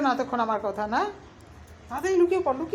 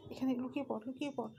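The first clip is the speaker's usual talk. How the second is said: whispering while hiding; 4 semitones lower, 8 dB quieter than usual. Pretend. Urging pet to hide, grandpa arriving.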